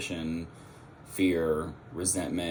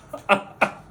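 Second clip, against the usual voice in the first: short laugh; 10 semitones higher, 3 dB louder than usual. Real, not acted. Laughs at own lisp.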